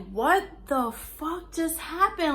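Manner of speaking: high, slow, stunned what-the-fuck; reliving the moment of panic after the crash; panicked quote. Retold or imitated. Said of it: "I'm panicking".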